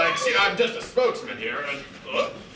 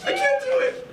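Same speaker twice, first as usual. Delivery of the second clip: much higher, hammed-up panic; mock panic; faster than usual. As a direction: squeal like a scared kid. Panicked child attacked by cat.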